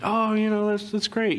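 high, light fake-pleased voice; chirpy pretend congratulations; mock cheerful praise. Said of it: "everybody's like".

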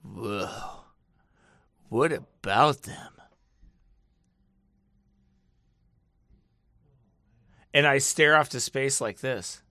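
slow, low, dazed stoner; drawn-out stoner wonder. Acted. Mocking conspiracy believers as a dazed stoner. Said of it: "I know I sound like a stoner".

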